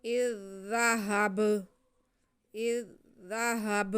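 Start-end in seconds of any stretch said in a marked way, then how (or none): 0.0-1.7 ब ends with a bouncing qalqala echo; the final ब released with a small echo. Shown as a demonstration. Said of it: "इस पे कलकला भी करेंगे".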